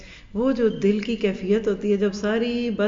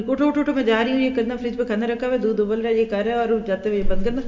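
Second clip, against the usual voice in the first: hurried instructions; faster than usual. Retold or imitated. Mother rushing sleeping child.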